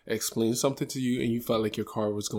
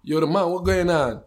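lightly higher imitation of Elijah's accent; a hint of Elijah's accent, higher; imitating Elijah; 7 semitones higher than usual. Mimicking Elijah complaining to his friend, a light accent. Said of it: "So you be like".

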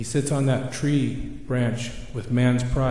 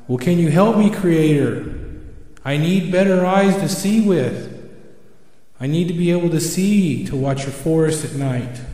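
pleading owl; 5 semitones higher than usual. Acted. Owl setting pride aside to ask Creator, pleading. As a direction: ask for help with pitch raised, easing by the end.

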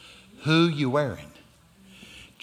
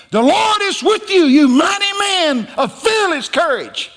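high, loud shouted greeting, drawn out; booming proclamation; 7 dB louder than usual. Acted. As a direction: boom it out to startle a frightened man. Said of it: "scared the spit out of Gideon".

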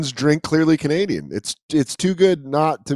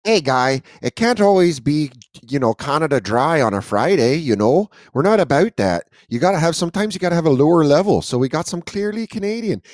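lilting put-on Canadian accent; mock Canadian accent; 2 semitones higher than usual. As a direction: play the folksy Canadian pitchman. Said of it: "wildly offended by our accents".

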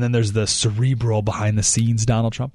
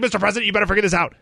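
freaking out; 6 semitones higher, much faster than usual. Retold or imitated. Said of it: "like, freaking out".

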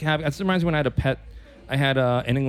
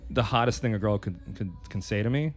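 ordinary talk, nothing marked

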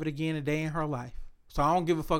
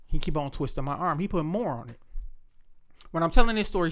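usual speaking voice

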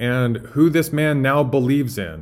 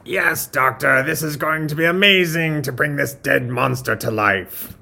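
higher-pitched eager henchman voice; Igor; 3 semitones higher than usual. Acted. Assistant to doctor over the monster, eager henchman voice. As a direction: play the devoted lab assistant. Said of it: "imagine Doctor Frankenstein and Igor".

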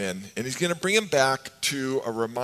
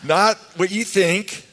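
louder, slower, teasing the crowd; playful correction; 7 semitones higher, 7 dB louder, slower than usual. Real, not acted. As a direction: reassure them with a grin you can hear. Teasing the congregation.

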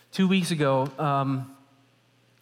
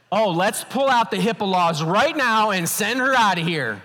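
high, louder sarcastic outburst; hammed-up outrage, pitched up and loud; mock indignation; 6 semitones higher, 5 dB louder than usual. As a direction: rant in exaggerated exasperation. Retold indignant wish to eject wife.

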